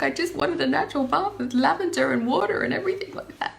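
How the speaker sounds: imitating her past self, high and yearning; retold wish. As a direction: voice it like a longing, tearful wish. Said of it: "I was like".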